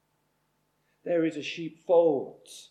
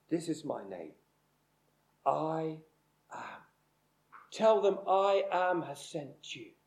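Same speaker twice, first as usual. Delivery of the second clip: much softer, hushed and reverent; hushed voice of God; 2 semitones lower, 6 dB quieter than usual. Acted. God reveals his name to Moses, hushed and reverent. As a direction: let God's name fall softly, almost breathed.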